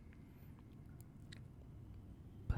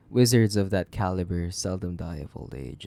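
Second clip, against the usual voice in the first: normal talking voice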